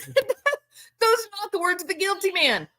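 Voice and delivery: high, laughing insistence; amused, high-pitched protest; amused disbelief. Real, not acted. Repeats exclamation after laugh.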